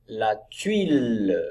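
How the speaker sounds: slow, drawn-out model reading; word-by-word reading. Shown as a demonstration. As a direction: stretch the word out for beginning readers. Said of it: "Allez, on enchaîne".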